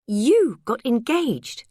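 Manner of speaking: amazed gasp of a question; astonished. Acted. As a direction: drag it out in disbelief. Sarah astonished at the news.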